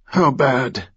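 gritted teeth, strained and tight; through clenched teeth. A narrator's reading. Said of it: "Through clenched teeth".

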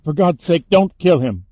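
loud, high desperate plea; crying out. A narrator's reading. Captive begging for her friend's life, crying out. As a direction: plead loudly and urgently. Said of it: "Lauren cried".

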